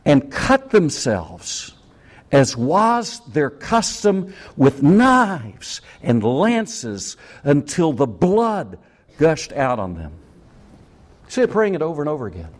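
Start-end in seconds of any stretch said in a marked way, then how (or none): 0.0-10.1 slow dramatic reading. Acted.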